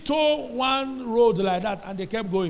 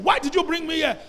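high-pitched, mildly raised protest; retold scream; 8 semitones higher than usual. Wife confronting her husband after the flogging, retold scream. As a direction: imitate a distraught scream, pitched well up. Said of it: "So she started screaming".

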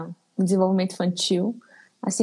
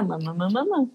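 imitating baby babble; 3 semitones lower than usual. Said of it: "E aí ele vai começar".

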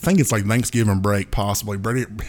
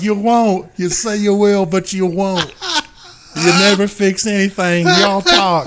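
high, louder exasperated scolding, laughing through it; 9 semitones higher, 6 dB louder than usual. Retold or imitated. Friend scolding about never fixing anything, laughing exasperation. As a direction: scold with mock disbelief. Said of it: "exasperation in his voice".

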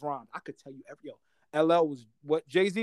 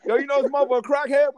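put-on excited kid gossip; 9 semitones higher, 7 dB louder, faster than usual. Acts out kids gossiping about crack.

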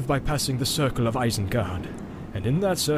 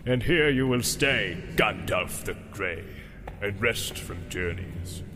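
open scorn; 4 dB quieter than usual. A narrator's reading. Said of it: "concealed his scorn".

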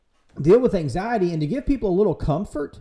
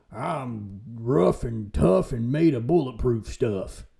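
slow, lightly put-on swagger; a hint of mock bravado, drawn out; tough-guy act; 3 semitones lower, slower than usual. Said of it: "That's an act".

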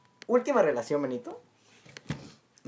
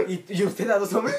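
higher, laughing comeback; laughing disbelief; 6 semitones higher, 4 dB louder than usual. Real, not acted. Host turns the teasing back on the guest, laughing in disbelief. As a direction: throw the question back through laughter.

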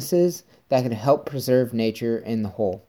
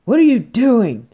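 much higher, louder imitated hunter voice; annoyed hunter; 11 semitones higher, 6 dB louder than usual. Hunter confronting the filming neighbour, higher mock angry yell. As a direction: play the irate hunter catching a trespasser. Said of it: "He's like".

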